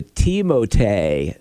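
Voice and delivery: "Timothée" as tee-mo-TAY; correct French form. Shown as a demonstration. Host correcting the first name, correct French form. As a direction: say "Timothée" slowly, ending in TAY. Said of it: "that you got wrong".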